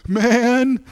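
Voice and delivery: high, frantic opener; retold urgent call. Driver phoning a friend about a crash, acted urgent cry. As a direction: blurt it high, acting out alarm.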